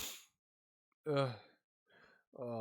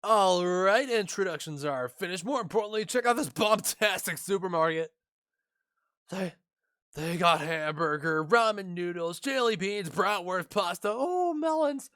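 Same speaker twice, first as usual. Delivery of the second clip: higher and louder, rattling off a grand list; boastful excited chef; 6 semitones higher, 4 dB louder than usual. Acted. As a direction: show off the supermarket like a showman. Voices boastful chef listing foods.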